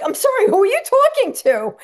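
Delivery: very high, loud mock indignation; incredulous retort. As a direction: fire back in high-pitched, loud disbelief.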